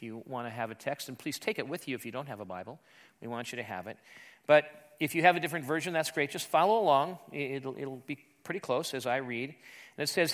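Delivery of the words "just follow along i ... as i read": ordinary talk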